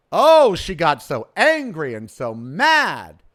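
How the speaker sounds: slow, drawn-out mock concern. Real, not acted. Host scoffing at an offended woman's anger, mocking. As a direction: stretch it out to belittle her anger.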